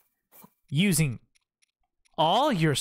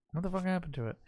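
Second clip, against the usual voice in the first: ordinary talk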